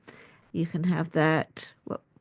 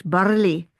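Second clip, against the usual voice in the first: barley in a mock Scottish accent, rolled r, a bit louder; 4 dB louder than usual. Shown as a demonstration. Doctor jokingly offering viewers a Scottish accent. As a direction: try barley the Scottish way, r sounded. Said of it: "I could try Scottish".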